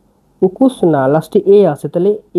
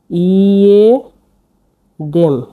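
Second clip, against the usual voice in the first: drawn out syllable by syllable; much slower than usual. Shown as a demonstration. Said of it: "এটা ইয়েদেম".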